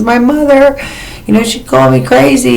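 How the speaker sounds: high, put-on voice, lightly plaintive; telling a friend, lightly playing up her hurt; whiny self-quote. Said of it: "I says".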